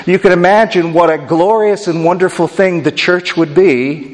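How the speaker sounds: ordinary delivery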